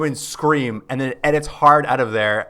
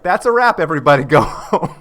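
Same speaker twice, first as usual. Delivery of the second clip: a mock boss calling a loud, high wrap to the set; calling out to crew; 4 semitones higher, 6 dB louder than usual. Director ending the shoot calls loudly and cheerfully to everyone. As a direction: shout it across the studio floor, playfully.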